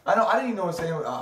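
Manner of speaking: ordinary talk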